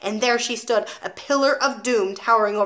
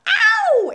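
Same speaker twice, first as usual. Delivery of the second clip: very high, loud yelp; child's piercing "ow"; cry of pain; 7 dB louder than usual. A narrator's reading. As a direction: yelp as if ears are pinched. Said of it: "Eric cried".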